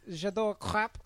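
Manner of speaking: put-on J'adore crêpes in the teacher's Romanian-French accent. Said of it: "so she'd be like".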